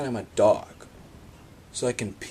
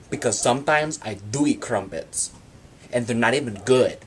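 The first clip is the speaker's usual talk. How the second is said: indignant rant; 4 dB louder than usual. Pretend. Defending himself to doubters, indignant and loud. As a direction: get up in their face about being judged. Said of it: "And then I'm all like".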